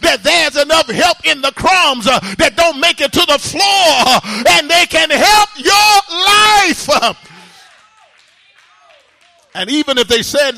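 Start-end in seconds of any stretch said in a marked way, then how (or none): 0.0-7.2 loud, rhythmic sermon delivery with uh breaths; fiery preaching. Acted. Preacher urging the church to call on God, fiery preaching. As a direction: preach it hard to the congregation.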